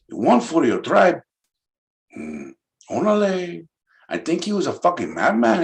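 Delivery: hammed-up Chicano accent. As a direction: talk like a laid-back Chicano homie. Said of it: "Chicano of SoCal".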